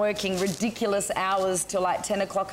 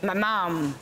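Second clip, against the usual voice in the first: ordinary talk, nothing marked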